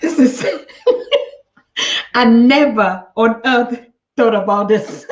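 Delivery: amused disbelief through laughter; real laughter breaking into the words; laughing. Real, not acted. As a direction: laugh while getting the words out. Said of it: "I'm laughing because".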